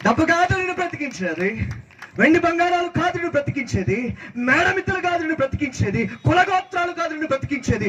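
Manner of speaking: high, insistent rhythmic refrain; full-force preaching. Real, not acted. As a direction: drive each denial home in a high cry. Preacher's rhythmic list of denials. (ఆవేశంగా ఎత్తైన స్వరంతో ప్రతి మాటా నొక్కి చెప్పడం)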